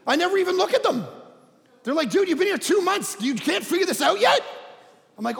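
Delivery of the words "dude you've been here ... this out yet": incredulous teasing, raised voice; mocking coworkers. Coworkers mocking new guy.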